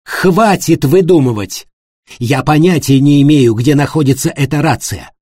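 loud, high furious denial. A narrator's reading. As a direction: lash out in fury. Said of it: "Аника в ярости вскочила".

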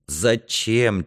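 usual speaking voice

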